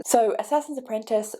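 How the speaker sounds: normal talking voice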